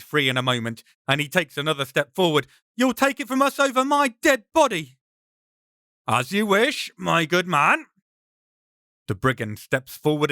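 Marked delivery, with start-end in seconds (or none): 2.8-5.0 much higher, defiant cry. Acted. Ben draws sword, defiant. 6.1-7.9 mocking brigand, higher; a hint of a menacing highwayman. Brigand accepts challenge mockingly.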